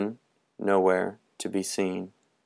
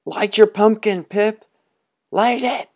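much higher, loud urgent shout; urgent call; 13 semitones higher, 6 dB louder than usual. Acted. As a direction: boys urging Pipkin to relight. Boys urging Pipkin in dark.

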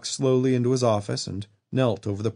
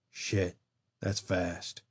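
softer, flat-toned and uninterested; disinterested monotone; 3 semitones lower, 8 dB quieter, much slower than usual. A narrator's reading. Dying old man watching the dead medic twitch, disinterested monotone. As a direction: say it like nothing matters anymore. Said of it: "in a disinterested monotone".

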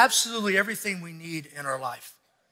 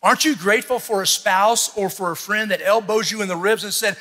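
ordinary talk, nothing marked

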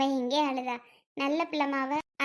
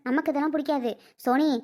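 ordinary delivery

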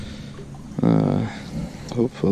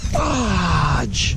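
a sharp, higher "Fudge!" at a lost fish; frustrated exclamation; 6 semitones higher than usual. Real, not acted. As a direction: bite off the word in annoyance.

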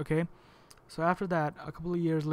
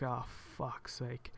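much softer, slow muttered exasperation; exasperated groan; 3 semitones lower, 12 dB quieter, much slower than usual. Retold or imitated. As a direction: sag with defeat. Seeing package go to USPS.

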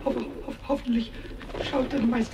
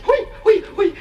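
high excited hui; excited squeal; 7 semitones higher, 6 dB louder than usual. Acted. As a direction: squeal with glee. Pumuckl sees doves coming.

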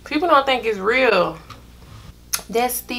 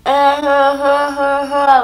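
mock gagging huffs; higher retching huffs; 6 semitones higher, slower than usual. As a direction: act out gagging. Gagging on dog food.

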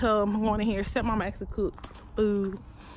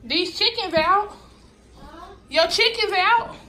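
calling out; 7 semitones higher than usual. Real, not acted. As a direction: shout for her from far off. Calling stepmother across farm.

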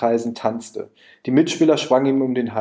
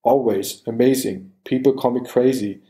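robot voice. Retold or imitated. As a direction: imitate a speech computer. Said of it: "wie der Sprachcomputer von Stephen Hawking".

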